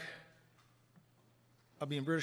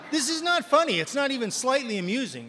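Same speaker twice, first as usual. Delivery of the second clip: loud, higher, mock-outraged thought; higher, louder comic indignation; indignant inner shout; 8 semitones higher, 9 dB louder than usual. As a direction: shout it in your head, offended. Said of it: "I'm thinking".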